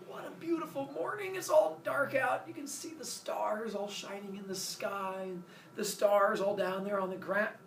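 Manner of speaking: high, airy character voice; blissful morning Zeus. Acted. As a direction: greet the morning in hammed-up contentment.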